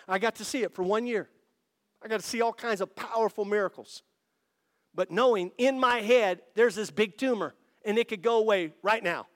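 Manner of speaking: high, loud, driving sermon delivery; testimony rising into a shout; full-force preaching. Real, not acted.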